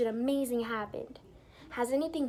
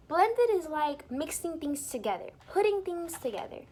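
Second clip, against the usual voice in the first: ordinary delivery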